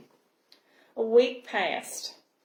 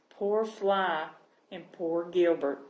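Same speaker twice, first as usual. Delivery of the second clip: usual speaking voice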